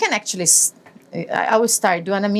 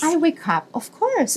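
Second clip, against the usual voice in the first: normal talking voice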